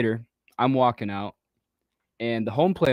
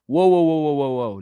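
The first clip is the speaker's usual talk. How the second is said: rapid whoas starting high and loud, then easing; mock-protest to halt a bad call; retold alarmed protest; 5 semitones higher, 4 dB louder than usual. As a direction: cut in with a startled objection.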